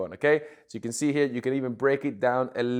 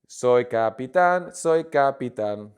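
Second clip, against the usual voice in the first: loud, slow, drawn-out practice phrase; slow pronunciation demo; 6 dB louder, slower than usual. Shown as a demonstration. Teacher drilling pronunciation with the accent, slow and loud. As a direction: stretch it out syllable by syllable for learners. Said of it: "practice the pronunciation with the accent".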